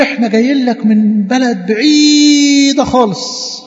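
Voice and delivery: high, slow, hugely stretched vowel; exaggerated distance. Strangers tell Joshua of their long journey, exaggerated drawl. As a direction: stretch the word "بعيدة" out very long.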